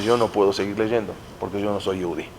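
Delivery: hushed, tearful confession; low, choked whisper of a weeping king; crying. Retold or imitated. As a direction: say it softly like a king close to tears. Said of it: "empieza a llorar Agripas".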